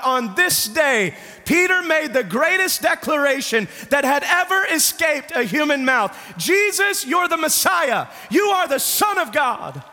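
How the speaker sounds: high, ringing preacher's cry; preaching at full force. Acted. Preacher shouting Peter's declaration to the congregation, fervent. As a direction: proclaim it with full fervour.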